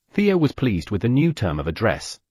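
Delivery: plain reading voice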